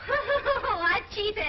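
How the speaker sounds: high, loud peals of laughter round the words; laughing. Acted. Wife amused by husband's accusation of cheating, laughing. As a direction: break up laughing as you say it.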